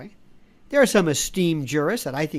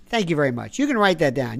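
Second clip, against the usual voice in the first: ordinary talk, nothing marked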